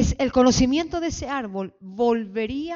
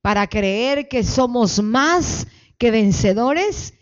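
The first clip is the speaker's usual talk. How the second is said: loud preaching declaration, phrase by phrase; fervent led prayer; 3 semitones higher, 8 dB louder than usual. Real, not acted. Pastor leading the congregation in prayer, fervent declaration. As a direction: proclaim it with force for a crowd to repeat.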